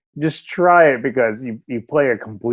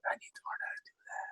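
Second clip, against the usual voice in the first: fast breathy whisper to himself; whispered aside; 24 dB quieter, much faster than usual. Retold or imitated. Envying Messi's free kicks, quick whispered aside. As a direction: whisper it quickly like a secret wish. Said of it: "and I'm like".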